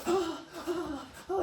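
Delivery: high, gasping mock moans; imitated orgasmic gasps. Storyteller imitating a woman's sudden release, mock orgasmic gasps. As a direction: gasp and moan in imitation, pitched high. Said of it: "like, a full-on orgasm".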